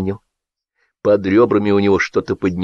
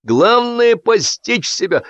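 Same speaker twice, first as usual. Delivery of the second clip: high, drawn-out sage tone; drunk pronouncement; 13 semitones higher, slower than usual. A narrator's reading. Drunk Kytin breaking the silence, pompous.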